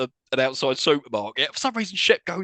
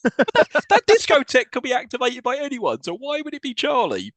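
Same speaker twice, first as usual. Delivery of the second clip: high, louder protesting; excited; 10 semitones higher, 4 dB louder than usual. Real, not acted. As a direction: argue the point eagerly. Heated argument.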